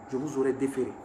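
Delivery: put-on commissioner's line in a light Senegalese accent. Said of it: "Avec l'accent sénégalais". (accent sénégalais léger du commissaire)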